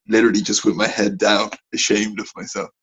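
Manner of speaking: ordinary talk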